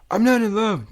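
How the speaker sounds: high, loud insistent denial; insisting. Acted. Twilight insists to Cadance she is not in love, high and firm. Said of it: "Twilight insisted".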